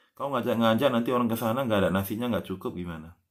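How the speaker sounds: normal talking voice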